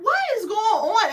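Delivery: high, loud stunned question; shocked disbelief. Retold or imitated. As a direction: blurt it out in disbelief. Speaker's own shocked reaction.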